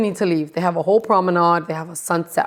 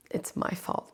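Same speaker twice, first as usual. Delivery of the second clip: whisper; 15 dB quieter than usual. Real, not acted. Bitter aside about blame.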